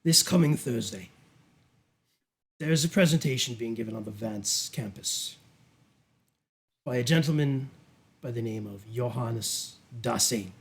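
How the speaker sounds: low, measured briefing with deliberate pauses; sinister duke. Acted. Duke sending Bridget to a presentation, coolly commanding. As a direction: hand down instructions with unhurried authority. Game master plays the Duke.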